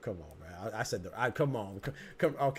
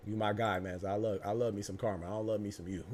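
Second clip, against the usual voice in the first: ordinary talk, nothing marked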